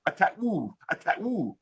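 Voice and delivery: tattoo with the middle T swallowed; imitated dropped T's. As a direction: mimic British tattoo as ta'oo. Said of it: "you think I talk funny?".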